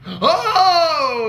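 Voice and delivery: a loud delighted laugh on oh. Real, not acted. Remembering the threesome, laughing loudly. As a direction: burst out laughing as it comes back.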